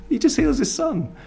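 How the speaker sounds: delighted. Real, not acted. Delighted punchline after laugh.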